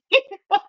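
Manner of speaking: a high burst of laughter. Real, not acted. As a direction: laugh at the absurd thought.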